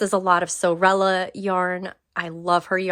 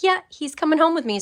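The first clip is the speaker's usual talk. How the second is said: excited; 9 semitones higher, 3 dB louder, faster than usual. Retold or imitated. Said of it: "and I'm like".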